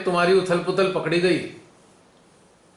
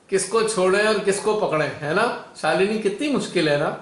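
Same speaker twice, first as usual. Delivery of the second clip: normal talking voice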